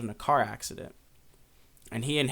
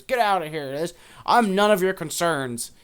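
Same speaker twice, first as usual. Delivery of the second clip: loud, raised-pitch angry shout; yelling; 5 semitones higher, 7 dB louder than usual. Retold or imitated. Old man throwing dishes at gossips, yelling at them. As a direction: chase them away with your voice. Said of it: "he's yelling at him".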